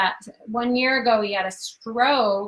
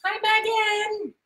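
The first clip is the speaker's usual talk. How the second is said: sing-song greeting; 10 semitones higher, slower than usual. Real, not acted.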